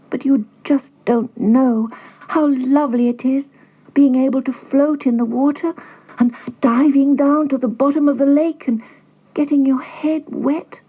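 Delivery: high, longing duckling voice. A narrator's reading. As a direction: plead like a dreamy duckling. Duckling dreams of water.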